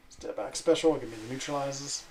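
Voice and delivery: normal talking voice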